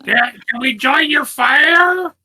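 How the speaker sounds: high, slow goblin squeak. Acted. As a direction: call out to the camp in a high goblin voice. Splug calls to goblin camp.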